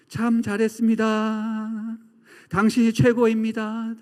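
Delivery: slow, drawn-out ringing call; sustained level tone, last syllable held and fading; echoing praise. Acted. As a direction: let the compliment resound like a mountain echo. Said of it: "메아리로 울려 퍼지는".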